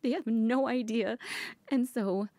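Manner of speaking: angry partner defending you; furious. Acted. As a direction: protective fury rising at whoever hurt them. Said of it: "I'm shaking 'cause I'm furious".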